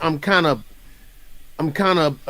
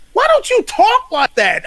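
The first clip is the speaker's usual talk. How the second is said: exasperated question; 5 dB louder than usual. Retold or imitated. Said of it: "You're like".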